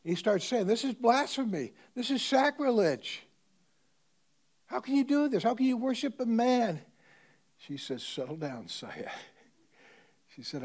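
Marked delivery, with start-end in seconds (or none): 0.7-6.8 loud, high, indignant shouting; student shouts angrily at his teacher; outraged protest. Retold or imitated. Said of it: "he gets excited". 8.0-9.1 calming. Retold or imitated. Teacher calms angry student.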